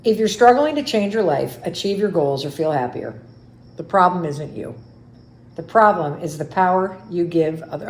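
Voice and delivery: proudly reading her own book opening; reading her book aloud. Acted. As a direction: read the opening like a showcase.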